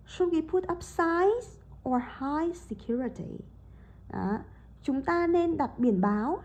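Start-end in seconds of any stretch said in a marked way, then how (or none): 0.1-3.4 "Should we put up signs or hire security?" slow, up on "signs", down on "security"; choice intonation. Shown as a demonstration. Teacher repeats the recording for the class: rise before "or", fall after. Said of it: "Băng có đọc là gì?".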